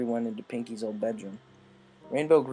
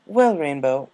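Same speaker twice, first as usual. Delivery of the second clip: Rarity voice; 3 semitones higher, 12 dB louder, slower than usual. A narrator's reading.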